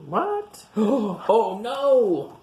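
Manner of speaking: high, gasping what-oh-no; shocked gasp. Pretend. Reader to child, shocked the sloth is boxed up. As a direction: act out alarm at the picture.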